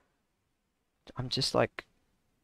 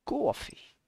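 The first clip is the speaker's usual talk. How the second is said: high, unvoiced New York "Coffee" with a broad aw; New York accent; 13 semitones higher than usual. Streamer showing the New York "Coffee" accent. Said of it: "Boston, New York".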